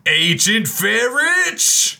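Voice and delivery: high, long-drawn holler; mock outraged cry. Garrett learns the flower was taken, high drawn-out cry. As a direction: cry out the name in hammed-up outrage. Player calls out the culprit's name.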